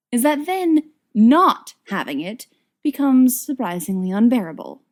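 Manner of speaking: ordinary delivery, nothing marked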